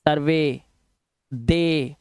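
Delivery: सर्वे and दे with a long ay ending; ay sound. Shown as a demonstration. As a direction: say सर्वे, दे low and drawn on the ay. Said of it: "ए की आवाज".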